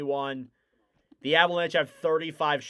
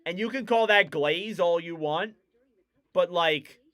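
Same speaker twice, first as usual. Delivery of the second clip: ordinary talk, nothing marked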